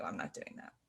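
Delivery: very low, soft private refusal; reluctant inner thought. Retold or imitated. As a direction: mutter it to yourself as a remembered thought. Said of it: "I literally just remember thinking".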